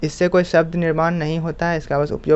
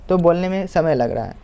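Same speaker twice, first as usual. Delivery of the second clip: ordinary delivery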